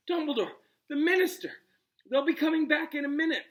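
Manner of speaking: high, loud urgent plea. Acted. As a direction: urge in a panic. Hermione urging Ron, panting.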